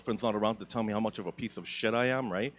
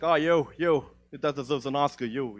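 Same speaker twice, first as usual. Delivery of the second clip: Robert De Niro; 3 semitones higher than usual. Retold or imitated. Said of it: "Robert De Niro would have been super, like, pleased and be like".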